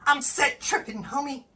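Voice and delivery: put-on high, soft, chirpy clean-cut kid voice; wholesome 1950s kid. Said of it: "the equivalent of "Hiya, pal".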